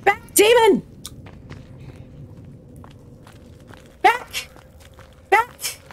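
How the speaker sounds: high, short repelling cries; mock warding cry. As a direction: fend off pretend demons with high yelps. Warding off game demons.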